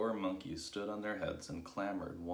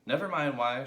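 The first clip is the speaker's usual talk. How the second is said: loud, raised dismissal; impatient shout; 7 semitones higher, 9 dB louder than usual. A narrator's reading. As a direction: shout down the question. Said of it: "shouted Mugglewump".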